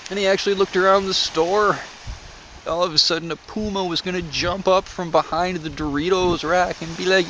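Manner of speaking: usual speaking voice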